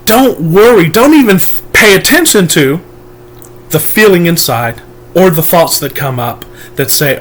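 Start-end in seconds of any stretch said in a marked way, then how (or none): none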